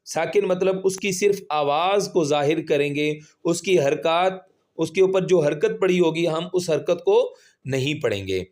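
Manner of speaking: ordinary talk, nothing marked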